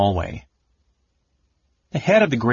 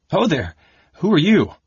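higher, louder startling call; sudden loud challenge; 9 semitones higher, 5 dB louder than usual. Acted. A stranger suddenly calls out to the startled scholar, loud. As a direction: bark it out of the darkness.

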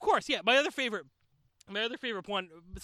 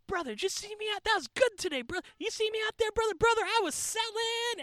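high-pitched hyped-up brag, slowing on the last brother; imitating a pumped-up wrestler fishing for praise; bragging wrestler; 12 semitones higher than usual. Wrestler bragging to the kid he watched grow up, high, hyped imitation.

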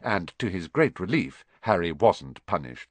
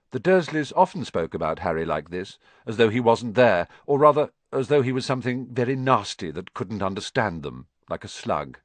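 ordinary delivery

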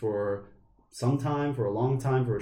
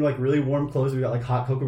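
ordinary delivery

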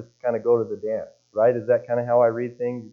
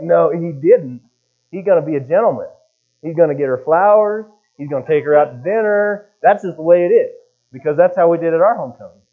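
louder, higher mock insistence; indignant insistence; 4 semitones higher, 6 dB louder than usual. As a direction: lay down the rules as an insistent neighbor. Neighbors' indignant insistence, retold.

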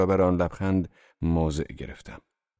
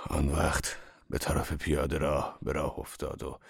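usual speaking voice

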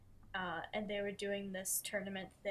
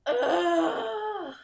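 whiny groan; 8 semitones higher, 12 dB louder, slower than usual. Real, not acted. Dreading reliving her story.

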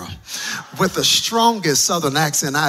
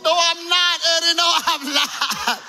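very high, loud, crying protest; mock sobbing denial; tearful wail; 5 dB louder than usual. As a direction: wail back at the song through tears. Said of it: "It's just a mess".

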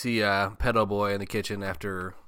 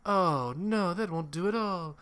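high, lilting mock-aunt voice; hammed-up sing-song scatterbrain; sing-songy aunt imitation; 8 semitones higher than usual. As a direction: flutter up high like a dotty aunt. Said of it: "everything she said was all sing-songy".

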